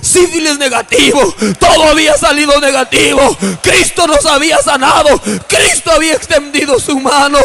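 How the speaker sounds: mounting preacher's fervor, punched phrases; exultant, building proclamation; triumphant testimony. Real, not acted. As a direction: proclaim the miracle in emphatic bursts. Climax: results are negative.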